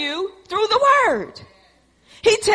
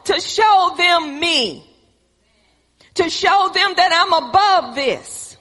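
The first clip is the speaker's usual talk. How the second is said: preaching; 3 semitones higher than usual. Acted. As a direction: preach it with building force. Preaching at full force.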